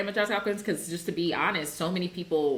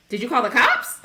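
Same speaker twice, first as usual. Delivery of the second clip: shocked outsider; 6 semitones higher, 6 dB louder than usual. Retold or imitated. Outsider reacting to professor story.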